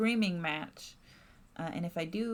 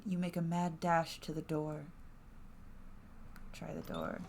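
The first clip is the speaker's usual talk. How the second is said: usual speaking voice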